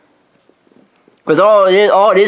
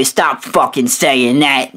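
normal talking voice

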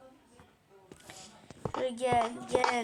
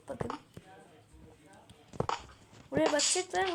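ordinary delivery

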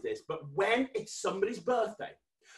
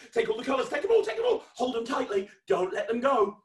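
higher, eager commands; excited urging; 7 semitones higher, 4 dB louder than usual. Pretend. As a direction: urge the kids on to grab them all. Urging children to grab imaginary colours.

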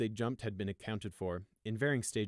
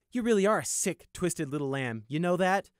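higher, louder disgusted jab; cold disgust; 8 semitones higher, 7 dB louder than usual. Acted. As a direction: sneer in disgust. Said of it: "he asked coldly".

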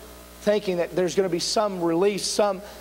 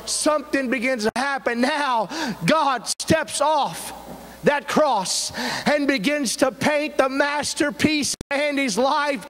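fervent preaching; 5 semitones higher than usual. Acted. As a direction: build to a ringing declaration of the call. Preaches the closing at full force.

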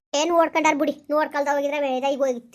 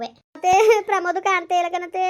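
high, loud tearful cry; 4 semitones higher, 7 dB louder than usual. Acted. Mother crying to neighbour that her son is gone. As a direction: burst into tears as you say it.

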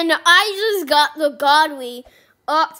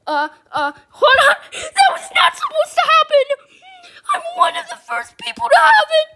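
ecstatic screaming; 10 semitones higher, 5 dB louder than usual. Real, not acted. Screaming over first-try mythic.

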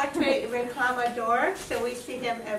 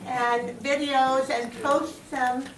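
ordinary talk

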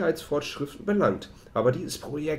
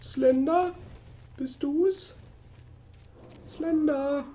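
nervous calling; 12 semitones higher, much slower than usual. Real, not acted. Calling to the monster.

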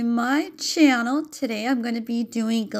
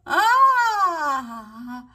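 relieved cry; 4 semitones higher, 7 dB louder than usual. Real, not acted. Peeling off the mask.

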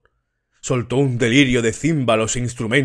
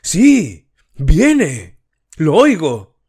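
excited cry; 5 semitones higher, 6 dB louder, much slower than usual. A narrator's reading. Ecstatic worshipper confirming.

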